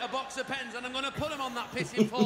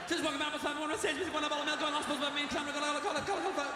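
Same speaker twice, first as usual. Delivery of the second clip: rushed gabble; 6 semitones higher, much faster than usual. Retold or imitated. Cramming words before the pips.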